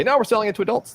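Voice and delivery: high-pitched dismissive quote; mock reassurance. Acting out the game's sellers.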